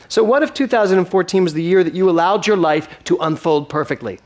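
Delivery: rising, earnest preacher build; preaching. Acted. Minister urging the congregation toward the new year, fervent preaching. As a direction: lift the congregation with each question.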